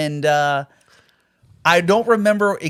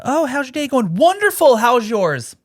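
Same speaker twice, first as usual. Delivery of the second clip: much higher, sing-song customer service cheer; fake cheerful work voice; 11 semitones higher, 4 dB louder than usual. Greeting customers at work, fake-cheerful falsetto. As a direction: put on the cheery facade. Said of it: "putting on the facade at work".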